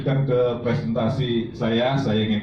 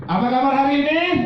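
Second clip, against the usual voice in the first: high, loud cheer leading the crowd; hyped call-out; 4 dB louder than usual. Real, not acted. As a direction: pump the audience up. Memimpin yel. (motivator memanggil jawaban hadirin dengan penuh semangat)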